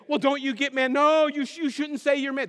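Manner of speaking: high, loud mock argument; hammed-up indignant protest; acted heated exchange. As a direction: bicker excitedly, voice pitched right up. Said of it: "then the Italians would say".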